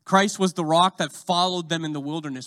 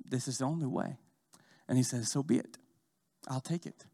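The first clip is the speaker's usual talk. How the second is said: hushed divine dialogue; 7 dB quieter than usual. Retold or imitated. The Father answering his son in Gethsemane, softly. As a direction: let it sink to a solemn hush. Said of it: "And the Father says".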